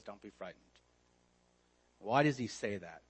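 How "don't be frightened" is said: gentle reassurance. Acted. Quoting Jesus' comfort softly.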